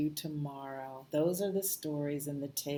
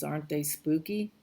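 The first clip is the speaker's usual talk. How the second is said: plain reading voice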